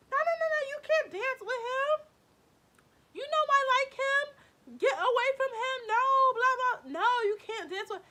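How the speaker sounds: high, very loud mock tantrum; jealous outburst. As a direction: shriek it like causing a big scene. Imagined scene she avoided making.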